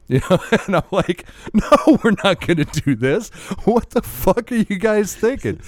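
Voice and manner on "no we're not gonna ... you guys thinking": mock outrage. Turning down two strangers in his dream, mock outrage. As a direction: protest in disbelief. Refusing the dudes.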